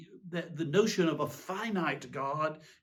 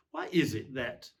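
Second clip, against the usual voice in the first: ordinary delivery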